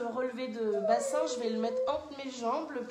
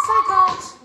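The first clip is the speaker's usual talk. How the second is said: effortful shout; 7 semitones higher, 19 dB louder than usual. Real, not acted. Final rep shouted with effort.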